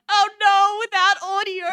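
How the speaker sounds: high, shouted cry of alarm; loud dismay. Real, not acted. Sudden dismay at missing audio.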